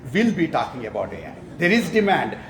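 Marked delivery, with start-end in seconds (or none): none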